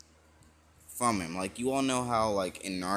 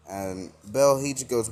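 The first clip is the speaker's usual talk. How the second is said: plain reading voice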